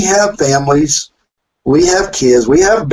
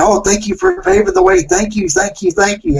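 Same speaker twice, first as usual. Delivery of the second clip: put-on 1 gushing kids. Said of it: "running by shaking our hands saying".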